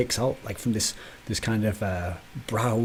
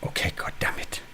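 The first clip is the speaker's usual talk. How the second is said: whispered frustrated curse; 3 dB quieter than usual. Real, not acted. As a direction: breathe it out, unvoiced. Whispered aside.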